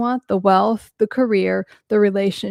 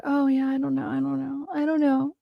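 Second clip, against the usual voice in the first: soft, higher-pitched playing dumb; mock helplessness; 5 semitones higher, 5 dB quieter than usual. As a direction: imitate someone feigning helplessness, small high voice. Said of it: "there are people that will do that".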